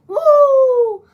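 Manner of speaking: high, loud wrestler woo; Ric Flair woo. Retold or imitated.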